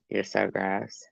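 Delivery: soft, slow tailing-off disgust; hushed, drawn-out revulsion; quiet disgust. Real, not acted. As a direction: let the disgust sink to an undertone. Repeated teasing disgust, fading.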